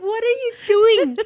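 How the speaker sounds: very high, mock-panicked question; high-pitched reenacted alarm; retold alarmed protest. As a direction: squeal it in protest at your mom. Protests as Mom twirls her foot.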